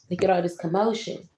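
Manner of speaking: ordinary delivery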